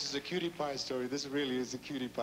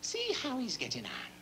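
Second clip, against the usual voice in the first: much higher Irish brogue, slowish; Irish bishop; 10 semitones higher than usual. Acted. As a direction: play the bishop in a high Irish lilt. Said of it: "So he says".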